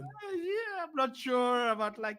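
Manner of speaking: high, hesitant mock fan; unsure fan voice. Mimicking unsure fans.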